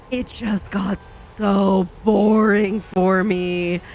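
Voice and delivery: very slow, drawn-out complaint; exaggerated boredom. Real, not acted. Reader explains abandoning the third book, exaggerated boredom. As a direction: drag out each word for emphasis.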